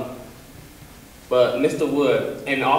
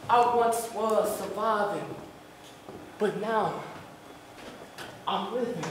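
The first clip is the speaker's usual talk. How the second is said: soft, high, with long pauses; hushed realisation, phrase by phrase; spoken-word performance; 7 semitones higher, 10 dB quieter than usual. Acted. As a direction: let each soft phrase hang.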